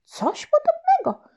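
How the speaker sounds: high, slow, shocked granny cry; grandma exclaiming. Acted. Grandma on the phone exclaims in high slow shock. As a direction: gasp it out in disbelief. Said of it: "zawołała babcia".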